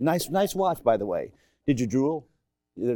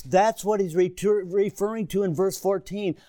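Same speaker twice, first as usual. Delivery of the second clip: ordinary talk, nothing marked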